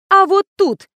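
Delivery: high, loud gloat; gleefully spiteful reveal. A narrator's reading. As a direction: announce it with malicious glee. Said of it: "злорадно ответила я".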